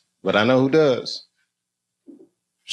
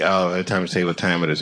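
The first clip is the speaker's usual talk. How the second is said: ordinary delivery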